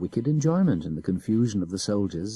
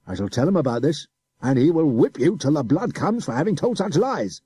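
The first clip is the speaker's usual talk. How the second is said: loud officer's threat; threatening adjutant; 7 semitones higher, 5 dB louder than usual. A narrator's reading. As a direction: menace the boy.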